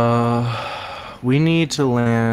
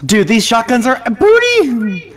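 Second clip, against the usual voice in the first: higher, louder, slow outburst; excited exclamation; 10 semitones higher, 6 dB louder, slower than usual. Real, not acted. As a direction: stretch it out in disgust. Excited complaint about shotguns.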